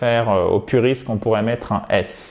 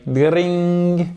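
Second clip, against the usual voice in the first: high, drawn-out "dring"; high ringing bell noise; imitated doorbell ring; 5 semitones higher than usual. Shown as a demonstration. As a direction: mimic a ringing bell on a held note. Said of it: "vous entendez la sonnerie".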